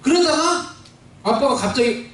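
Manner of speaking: normal talking voice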